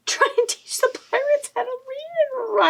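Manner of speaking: high-pitched, laughing incredulity; laughing disbelief. Real, not acted. Host reacting to a silly quiz answer, laughing high. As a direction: repeat it while cracking up.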